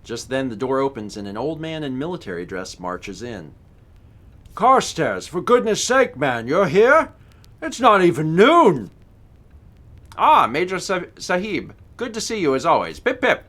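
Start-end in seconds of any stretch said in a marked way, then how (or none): 4.6-8.9 indignant old military man, lightly voiced; blustering old soldier greeting; old major. 10.2-13.4 put-on lightly done upper-class British accent; breezy British chap, jaunty. GM plays British Carstairs.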